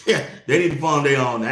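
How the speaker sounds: ordinary talk, nothing marked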